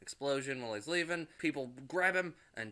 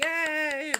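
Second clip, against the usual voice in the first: high, loud party cheer; crowd cheer; 8 dB louder than usual. Retold or imitated. Party guests cheering Tony's trick, loud and high. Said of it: "And everybody's like".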